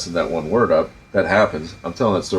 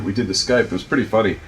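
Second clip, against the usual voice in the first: ordinary talk, nothing marked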